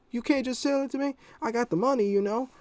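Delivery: very high, put-on boy voice; imitated kid. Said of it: "He goes".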